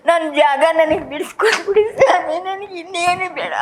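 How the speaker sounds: high, breathy sobbing plea; begging through tears; crying plea. Real, not acted. Grandmother begging officials to give back her land, crying. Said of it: "ಅಳ್ಬೇಡಿ".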